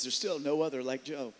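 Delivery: a hint of a steady divine voice; God's calm reply. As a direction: answer the accuser with quiet authority. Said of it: "Lord says".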